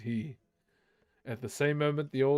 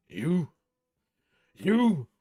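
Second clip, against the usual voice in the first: second You jumps higher and louder; outraged disbelief rising to a cry; angry cry; 3 semitones higher, 6 dB louder than usual. Acted. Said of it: "cried Thorin".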